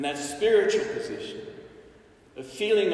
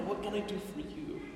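soft insistence; 12 dB quieter, faster than usual. Acted. Jesus gently insisting.

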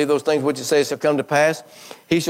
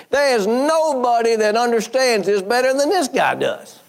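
climactic preaching; 7 semitones higher than usual. Real, not acted. Preacher voicing the centurion to Jesus, high and fervent. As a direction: ride the peak of the sermon.

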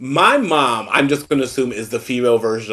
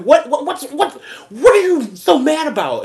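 higher, louder mock tirade, laughing through it; mock angry outburst; 14 semitones higher, 3 dB louder than usual. Daughter mock-yelling at her mother, laughing. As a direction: fire off the whats like a hammed-up rant. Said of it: "I sound like [name]".

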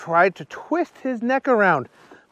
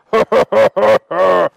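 loud, acted ho-ho laugh; dragon belly laugh; 2 semitones higher, 7 dB louder than usual. A narrator's reading.

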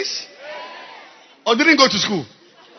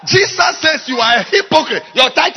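raised, higher preaching voice; scolding preacher; 4 semitones higher than usual. Real, not acted. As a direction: call out the hypocrisy from the pulpit. Preacher breaks in, scolding singer.

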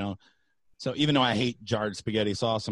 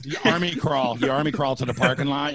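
normal talking voice

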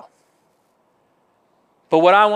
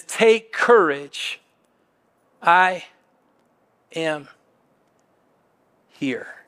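gentle reassurance; 4 semitones lower, much slower than usual. Acted. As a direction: let each word sink in, dropping to a hush. Voicing Jesus reassuring the disciples.

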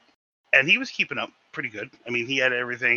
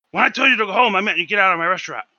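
louder, higher order to get out; angry boss; 8 semitones higher, 8 dB louder, faster than usual. Retold or imitated. Chef ordering the cook out of his restaurant, loud and angry. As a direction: throw him out of the restaurant. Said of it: "he's like".

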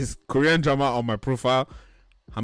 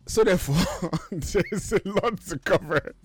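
suppressed laughter; 6 semitones higher than usual. Real, not acted. Host resuming the episode, barely holding in laughter. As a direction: push the words out through giggles.

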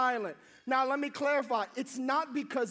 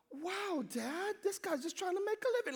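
higher, softer aside to dad; quietly appalled, pitched up; hushed disbelief; 4 semitones higher, 7 dB quieter than usual. Retold or imitated. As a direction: protest under your breath. Son's aside during retold argument.

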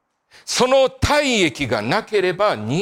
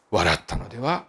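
ordinary talk, nothing marked

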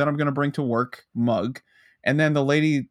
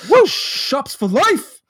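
hyped shout; 12 semitones higher, 7 dB louder, much slower than usual. Pretend.

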